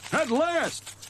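high excited Fred voice; excited cry. A narrator's reading. Fred unwraps his parcels, high excited cry. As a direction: burst out with glee. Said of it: "he cried".

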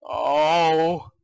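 usual speaking voice

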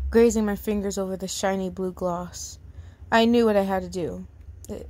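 narrated whisper. A narrator's reading. Reads a story aloud.

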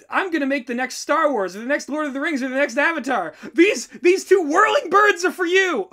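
fast, then high and loud triumphant taunt; hammed-up gleeful defiance; mock-heroic defiance. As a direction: fire both middle fingers with glee. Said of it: "who's like".

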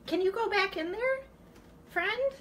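high, pleading question to a pet; high-pitched coaxing. Real, not acted. Woman asking mouse friend to go back, coaxing.

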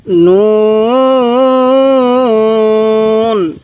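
নুন held very long; elongated recitation. Shown as a demonstration. Teacher reciting for viewers, নুন very slowly drawn out. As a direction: stretch the vowel slowly. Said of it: "আরেকবার পড়ব".

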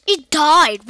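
loud amazed exclamation; real thrilled disbelief; excited shout. Real, not acted. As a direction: shout the surprise. Monster dies unexpectedly.

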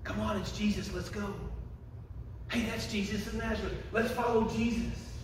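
hushed chatter among the crowd; passers-by talking. Acted. Passers-by within the blind beggar's earshot, softly spoken. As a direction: softly voice people in a passing crowd. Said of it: "he hears somebody say these words".

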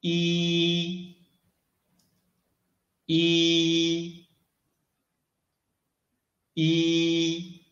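"E" as a long, high, level "ee"; first tone. Shown as a demonstration. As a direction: hold "E" on one high steady note. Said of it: "try to read E".

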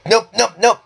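high, panicked refusal; panicked nope. Real, not acted. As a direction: back off in sudden panic. Sudden panic at an enemy.

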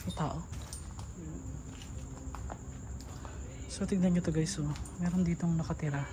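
low, hushed voice. Real, not acted. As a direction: keep it quiet, spooked. Quiet in creepy cemetery.

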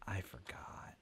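sheepish whisper. Real, not acted. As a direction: whisper it, embarrassed. Sheepish admission to NPC.

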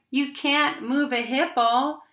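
a mild squall, stretched out; squalling parrot. A narrator's reading. Parrot tells the animals it can't be done, mild squall. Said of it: "squalled the parrot".

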